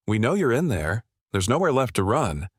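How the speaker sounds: plain reading voice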